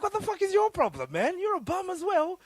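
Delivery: high, loud angry outburst at the friend; snapping back furious and loud; angry yell. Retold or imitated. Arguing with the friend who saved him, loud and angry. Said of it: "I'm like".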